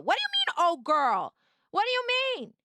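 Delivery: fake-offended squeal at a chat comment; mock outrage. Streamer teasing a viewer who typed oh girl, mock indignant.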